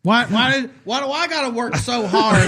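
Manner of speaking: high, whining mock rant; truck rant guy. Mocking a truck rant guy, in a high whining rant. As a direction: whine like an angry truck guy. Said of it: "ranting truck guy".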